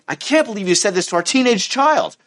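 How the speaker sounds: high-pitched, incredulous accusation; acted outraged reproach; angry parent. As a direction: confront your partner, appalled. Acted angry parent confronting partner.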